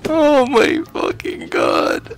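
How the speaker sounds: high, slow, stunned exclamation; stunned disbelief. Real, not acted. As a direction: drag it out in shock. Disbelief after record.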